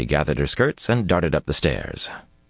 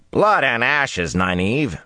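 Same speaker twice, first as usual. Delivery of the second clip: growled; 8 semitones higher, slower than usual. A narrator's reading. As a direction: growl it through frustration. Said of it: "Mat growled".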